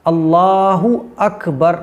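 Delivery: slow, clear अल्लाहु अकबर with a full u; correct Allahu Akbar. Shown as a demonstration. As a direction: spell out अल्लाहु अकबर slowly for the learners. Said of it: "तो ये सही pronunciation है". (अल्लाहु अकबर धीमे, साफ़ उच्चारण में)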